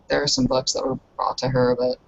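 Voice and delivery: ordinary talk, nothing marked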